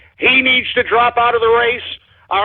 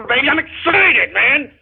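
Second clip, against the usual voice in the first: ordinary talk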